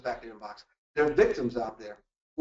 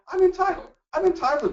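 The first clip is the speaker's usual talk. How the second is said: sudden high, louder smug declaration; mock entitlement; 4 dB louder than usual. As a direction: announce it like you own the building. Acting out entitlement in elevator.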